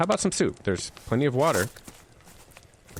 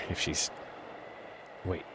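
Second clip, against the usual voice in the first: usual speaking voice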